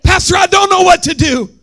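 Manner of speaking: loud, high cry for help from a church member; mock desperate congregant. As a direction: cry out to the pastor. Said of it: "voicing a congregant".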